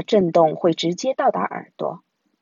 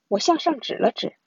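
usual speaking voice

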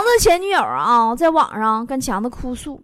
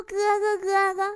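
higher, softer little-girl pleading, a hint of it; lightly voiced clingy little sister; 5 semitones higher, 6 dB quieter than usual. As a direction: ask your brother like a spoiled little sister, higher and softer. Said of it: "翰林小妹儿特别娇气啊". (娇气小妹妹的声音，音调稍高，声音较轻)

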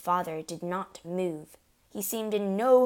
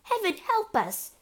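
very high, panicked plea; terrified cry; 4 dB louder than usual. A narrator's reading. Mother clutching her husband, terrified cry. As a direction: cry out for help in fright. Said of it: "cried the mother".

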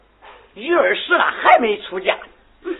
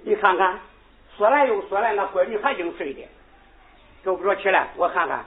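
ordinary delivery

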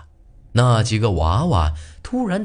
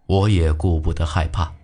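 ordinary delivery, nothing marked

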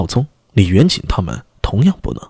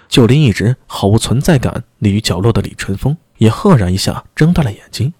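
plain reading voice

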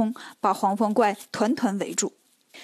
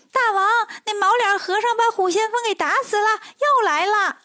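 high, breathless minion voice reporting bad news; panicky little demon; 8 semitones higher than usual. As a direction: squeak it out like a scared little imp running to his boss.

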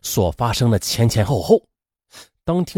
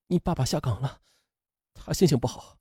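soothing; 10 dB quieter than usual. A narrator's reading. Mother soothes crying daughter.

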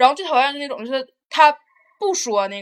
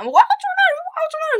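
much higher, shrill-pitched imitation of a bratty kid's tantrum yell; mock child scream; 4 dB louder, much faster than usual. A bratty kid on the bus screaming for the middle seat, mock tantrum shout. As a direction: shriek it like a spoiled child on the bus. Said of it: "那孩子跟疯似的喊".

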